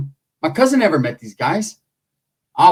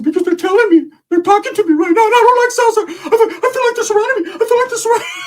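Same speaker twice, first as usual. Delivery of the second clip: much higher, louder possessed voice with laughter; spooked medium; 5 dB louder than usual. Retold or imitated. Ricky channeling spirits, very high and loud, laughing. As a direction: play the medium hearing spirits. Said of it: "He said".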